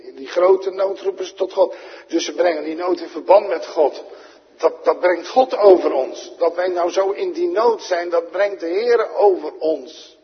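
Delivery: loud, high sermon voice; fervent pulpit delivery; preaching at full force. Acted. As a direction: drive the point home to the church. Preacher raising voice again.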